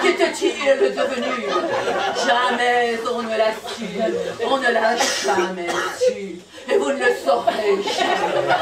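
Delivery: drawn-out solemn verse; stagey closing lines of a poem; poem recitation. Acted. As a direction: declaim the ending with theatrical weight.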